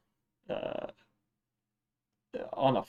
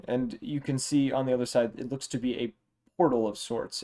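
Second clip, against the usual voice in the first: ordinary delivery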